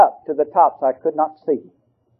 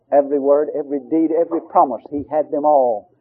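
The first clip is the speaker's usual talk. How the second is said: ordinary delivery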